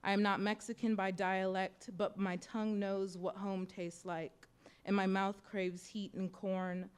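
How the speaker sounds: normal talking voice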